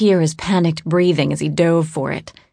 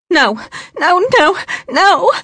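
high, frantic, choked pleading; choking, panicked pleading; 14 semitones higher, 4 dB louder than usual. A narrator's reading. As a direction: beg in terror, voice choking. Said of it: "she choked out".

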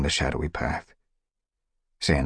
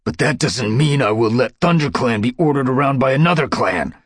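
higher, louder angry snarl; 8 semitones higher, 6 dB louder than usual. A narrator's reading. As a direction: spit out the defiance at the leader. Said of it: "snarled Dustpelt".

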